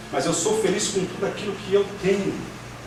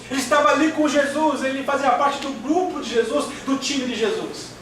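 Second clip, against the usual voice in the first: high-pitched, driving sermon delivery; preaching at full force; 7 semitones higher than usual. Real, not acted. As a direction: build the list with insistent fervour. (pregação fervorosa em tom alto)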